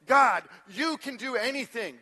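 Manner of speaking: high, loud, drawn-out declaration of faith; bold prayer. Shown as a demonstration. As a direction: pray it out boldly, stretching the words. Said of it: "balance between boldness and presumption".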